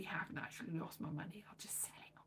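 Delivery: hushed unvoiced whisper. Real, not acted. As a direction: whisper it like a secret. Confides about losing money.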